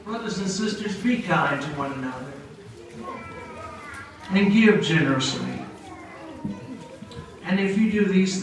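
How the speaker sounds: sermon-style blessing; preaching. Acted. David blessing listeners in a preacher's voice.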